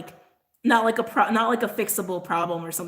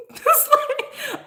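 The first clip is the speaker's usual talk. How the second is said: high disbelieving laugh; 5 dB louder than usual. Real, not acted. Recalling a pointless call, laughing in disbelief. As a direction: burst out laughing at the absurdity.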